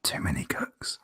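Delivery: very low, very soft whisper; whispered refrain. Real, not acted. Co-host repeating a quip after the rant, whispered aside. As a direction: repeat it under your breath.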